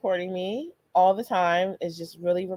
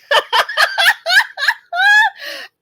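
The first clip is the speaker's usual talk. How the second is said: loud, very high peals of laughter; long squealing belly laugh; big laugh; 10 dB louder than usual. Real, not acted. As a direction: crack up completely, high and loud. Recalls daughter's question.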